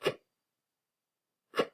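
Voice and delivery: k, made at the back of the throat; hard k, lips barely moving; crisp isolated k sound. Shown as a demonstration. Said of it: "So everyone say".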